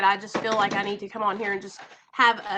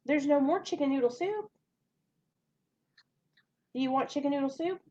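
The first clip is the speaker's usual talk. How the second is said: soft mom voice; 4 semitones higher, 4 dB quieter than usual. Real, not acted. Mom answering her child mid-stream.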